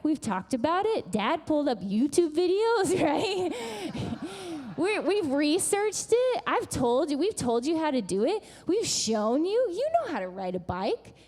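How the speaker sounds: insistent pep talk. Retold or imitated. Mother reminds daughter she was taught, insistent. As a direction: pile up the proof, insisting. Mother firmly encouraging her frustrated child.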